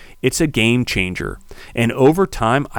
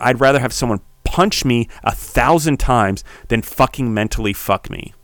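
ordinary talk, nothing marked

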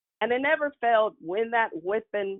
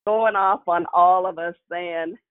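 normal talking voice

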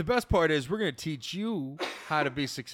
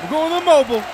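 shouted play call; 8 semitones higher, 9 dB louder, much faster than usual. Acted. Quarterback calling the play.

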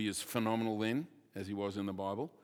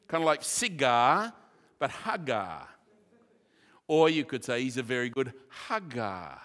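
ordinary delivery

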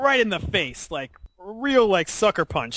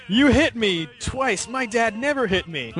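higher-pitched, indignant anime hero delivery; voice actor's dramatic line reading; 6 semitones higher than usual. Acted. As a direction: melodramatic recorded line in a raised pitch. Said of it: "recording the line, from that famous scene".

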